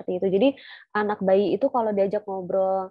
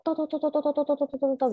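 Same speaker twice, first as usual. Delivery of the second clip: higher, rapid nonstop chatter; mock rambling; 6 semitones higher, much faster than usual. Shown as a demonstration. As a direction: mimic a parent lecturing on and on. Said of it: "Jadi jangan pidato".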